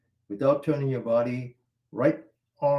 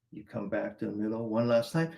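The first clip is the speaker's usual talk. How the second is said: normal talking voice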